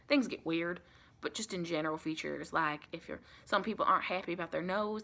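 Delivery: ordinary talk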